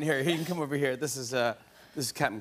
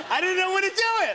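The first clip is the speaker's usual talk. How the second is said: laughing. Real, not acted. Laughing at own mistake.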